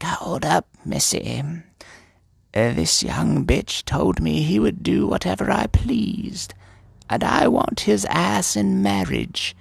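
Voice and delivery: high, screechy crone voice; old hag. Retold or imitated. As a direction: draw it out like a crone staking her claim. Voices old woman claiming the knight.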